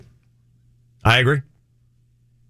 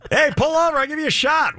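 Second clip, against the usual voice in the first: calling out. Acted. Roadside vendor calling out drivers.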